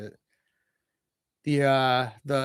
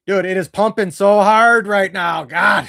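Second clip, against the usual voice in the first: loud, pitched-up burst of nerves; nervous excited shout; 3 semitones higher, 8 dB louder than usual. Real, not acted. Fan yelling at the tense final drive, excited shout. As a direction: blurt it out, too wound up to hold back.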